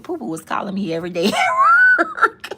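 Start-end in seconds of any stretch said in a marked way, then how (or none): 1.3-2.5 high loud laugh through the words. Real, not acted. Laughing as she recalls the calls, high and loud. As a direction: let the laugh break out on it.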